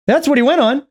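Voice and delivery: incredulous. Real, not acted. Sarcastic disbelief at guest.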